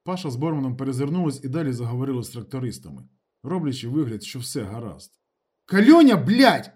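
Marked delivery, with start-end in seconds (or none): 5.6-6.7 furious high shout; loud, exasperated swearing; unrestrained anger. A narrator's reading. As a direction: lose your restraint and yell. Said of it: "Ніколаєч не стримувався".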